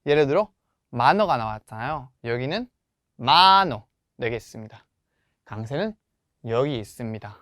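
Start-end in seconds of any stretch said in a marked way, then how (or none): 3.2-3.8 만호 with a long, strong first syllable; stress on second-last syllable. Shown as a demonstration. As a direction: lean hard on the first vowel of 만호, let the end drop. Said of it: "두 번째 모음의 강세를 놓아야".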